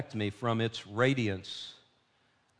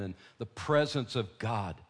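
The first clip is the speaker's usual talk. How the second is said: ordinary talk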